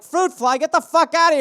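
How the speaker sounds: high, annoyed shooing; mock yell at fly. Shooing a fruit fly away, high and annoyed.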